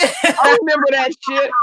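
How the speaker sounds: said through laughter; amused, laughing line. Real, not acted. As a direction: laugh while saying it. Laughing at recalled story.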